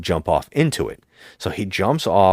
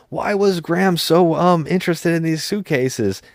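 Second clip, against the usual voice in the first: mock movie hero; 9 semitones higher than usual. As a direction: send up the hero's wooden line. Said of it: "he says".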